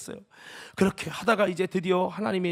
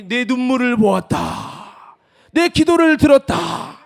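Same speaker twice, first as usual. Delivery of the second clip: God's voice; 3 semitones higher, 11 dB louder, slower than usual. Retold or imitated. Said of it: "하나님이".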